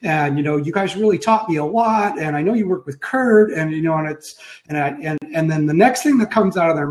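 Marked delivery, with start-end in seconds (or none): 0.0-3.5 eager fan. Retold or imitated. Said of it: "And they go".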